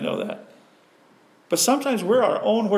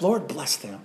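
quiet prayer; 5 semitones lower, 5 dB quieter, slower than usual. Shown as a demonstration. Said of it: "And I can still do that".

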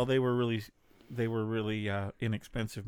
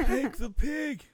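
high, slow squeaky pig quote; hammed-up high movie line; 14 semitones higher, 5 dB louder, slower than usual. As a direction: play the pig from the film. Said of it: "From Babe Pig in the City".

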